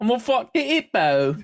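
hammy British cockney hippo lady; a cartoon British accent, high and loud; mock British accent. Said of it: "The hippo lady is just like".

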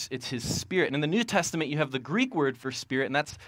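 usual speaking voice

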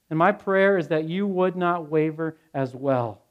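normal talking voice

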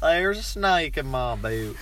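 slow Texan drawl on the catchphrase; playful cowboy accent; mock Texan accent.

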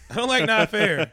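high, loud "not fair"; loud whiny complaint. Real, not acted. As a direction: whine it out loud. Laughing outburst.

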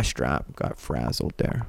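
a soft breathy whisper to herself; whispered aside. Real, not acted. Fixing her bra strap mid-podcast, in a soft whispered aside. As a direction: whisper it, almost inaudible.

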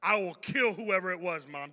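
loud, raging vow to find the culprit; retold furious threat. A big brother yelling at his crying mom, furious threat. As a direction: shout it out like a furious kid defending his mom. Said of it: "I'm like".

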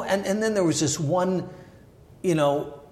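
normal talking voice

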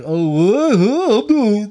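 mock drunken wailing, high and loud; hammed-up woozy oafish noises; oblivious drunk. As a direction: play a clueless drunk stumbling about. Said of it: "oblivious to, like".

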